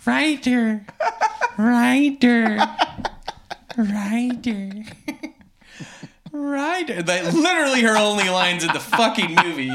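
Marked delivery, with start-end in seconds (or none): 0.1-7.0 higher drawn-out zombie call; zombie sister. Retold or imitated. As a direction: call the name like an undead sister. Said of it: "slowly walks at him like a zombie".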